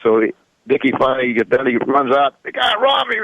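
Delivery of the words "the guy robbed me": high-pitched retold cry of alarm; high mock holler; mock yell. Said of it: "He started yelling".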